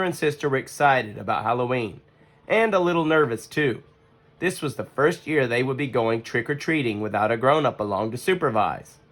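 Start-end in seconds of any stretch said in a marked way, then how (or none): none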